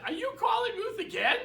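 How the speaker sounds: high-pitched mock wife voice; imitated nagging wife. Quotes wife's complaint about calls.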